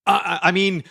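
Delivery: put-on high, flustered German accent; German accent slip. Spy covering a slip to waitress, high German accent. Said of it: "starts saying something in a German accent".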